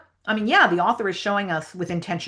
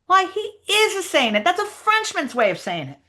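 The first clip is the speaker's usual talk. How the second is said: high, emphatic character voice; Huck Finn; 12 semitones higher than usual. Acted. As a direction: insist on it in a much higher pitch. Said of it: "read the Huck Finn lines".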